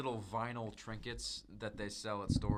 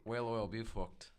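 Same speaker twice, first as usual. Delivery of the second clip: put-on Irish accent. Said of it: "like the Irish accent".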